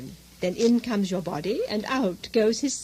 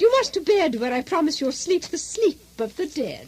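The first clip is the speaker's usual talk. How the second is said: ordinary delivery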